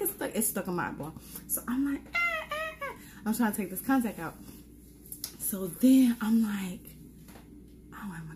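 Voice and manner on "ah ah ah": sharp high cry of pain. Retold or imitated. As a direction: yelp in pain at a stuck contact. Trying to pull contact out.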